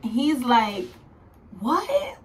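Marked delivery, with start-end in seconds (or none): 1.6-2.2 high, rising question; mock astonished yelp. Partner finally hearing about twins, high mock astonishment. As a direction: imitate sudden disbelief.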